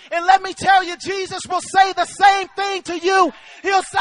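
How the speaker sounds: loud, urgent proclamation; rousing revival preacher; full-force preaching. Real, not acted. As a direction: drive it home to the congregation.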